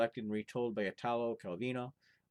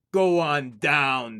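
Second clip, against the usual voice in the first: loud, slow directive; the giant directs him downstairs; slow order; 3 semitones higher, 13 dB louder, much slower than usual. Acted. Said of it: "directed the giant".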